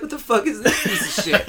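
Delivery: high, laughing outrage; a teenage fan laughing in disgust; laughing disbelief. Retold or imitated. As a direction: scoff through laughter. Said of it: "I was like".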